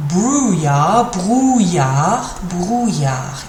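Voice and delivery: "Brouillard" with the double L said as a Y, repeated; pronunciation drill. Shown as a demonstration. Teacher drilling brouillard for learners, repeated slowly. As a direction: drill "Brouillard" three times, ll as a y sound. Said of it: "pronounced like a Y sound".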